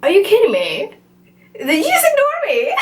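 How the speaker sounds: very high, incredulous squeal; retold outraged disbelief. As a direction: act out being affronted at being ignored, pitch shooting up. Retold confrontation after being ignored.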